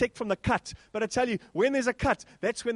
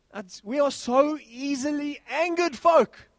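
higher, slow, building to a loud "folk"; preaching; 5 semitones higher, 4 dB louder, slower than usual. Acted. Preacher drives home easily angered, louder. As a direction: drive the point home to the church.

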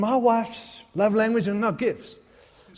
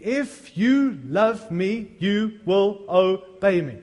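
slow scripture quote; much slower than usual. Retold or imitated. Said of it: "He said in John fourteen".

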